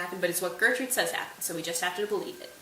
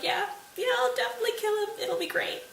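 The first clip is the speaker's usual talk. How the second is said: much higher, bouncy eager agreement; overeager Laertes; 13 semitones higher than usual. Acted. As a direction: gush like a hyped-up teen.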